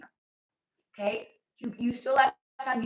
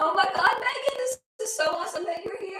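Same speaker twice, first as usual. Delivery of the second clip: high, loud gushing hello; excited greeting; 10 semitones higher, 7 dB louder than usual. Real, not acted. Streamer gushing over a viewer who joined, in a high, loud, excited voice. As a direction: greet her like a long-lost friend, much higher and louder.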